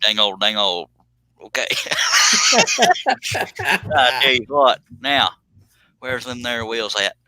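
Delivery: hammed-up Southern hillbilly accent. Host clowns for the viewers in a Southern hillbilly accent. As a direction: ham up a backwoods Southern drawl. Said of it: "Sound just like Honey Boo Boo".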